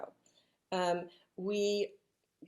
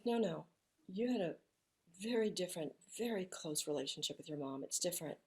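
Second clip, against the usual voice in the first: soft consoling; 2 semitones lower, 10 dB quieter than usual. Retold or imitated. Friend softly consoling her.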